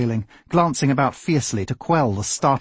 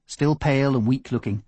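ordinary delivery

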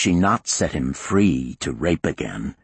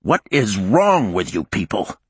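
bellowing; 4 semitones higher, 3 dB louder than usual. Acted. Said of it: "the warden bellowed".

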